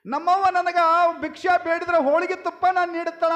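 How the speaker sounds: high, loud, brisk storyteller's delivery; loud declaration. Acted. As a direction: project it quickly to the whole crowd. Same thought repeated loudly to audience. (ಏರುದನಿಯ ಘೋಷಣೆ)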